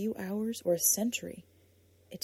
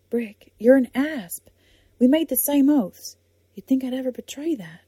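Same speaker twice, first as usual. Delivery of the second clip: louder, higher, indignant; higher-pitched, louder protest of loyalty; indignant outburst; 6 semitones higher, 11 dB louder than usual. Acted. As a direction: protest that she'd never betray him. Lark indignant at his doubt.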